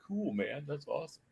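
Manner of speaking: very soft, offhand imitation of friends; hushed, casual mock approval; unbothered friends' reaction. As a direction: toss it off quietly, like an unimpressed buddy. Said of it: "they were just like".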